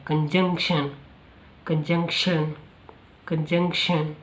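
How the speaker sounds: कंजंक्शन with the stress on the middle syllable; कंजंक्शन as "kun-JUNK-shun"; pronunciation demo. Shown as a demonstration. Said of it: "यहाँ पे थोड़ा stress ज़्यादा है".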